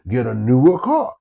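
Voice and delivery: ordinary delivery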